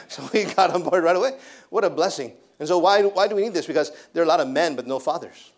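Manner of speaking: ordinary delivery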